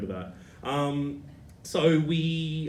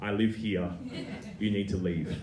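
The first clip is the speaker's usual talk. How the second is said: a lower, quieter "I live here" from the neighbour, losing patience; a firm order to get out, in a lower voice; flat firm; 6 semitones lower, 5 dB quieter than usual. Retold or imitated. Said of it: "this girl looks back at Mum and says".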